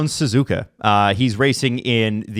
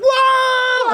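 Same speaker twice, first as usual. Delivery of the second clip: high excited whoop, much higher; mom's joyful whoa; 3 dB louder than usual. Retold or imitated. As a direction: whoop like a mom seeing her son score. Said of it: "she's like".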